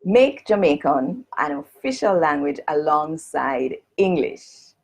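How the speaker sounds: "Make Jamaican an official language alongside English" in standard English. Shown as a demonstration. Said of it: "read it in Jamaican Standard English".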